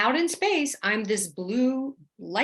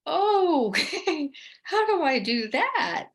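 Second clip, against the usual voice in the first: high "oh" breaking into a laugh; high-pitched, bewildered, laughing acceptance; overwhelmed reaction; 9 semitones higher, slower than usual. Retold or imitated. Told by the beings to fight evil, bewildered.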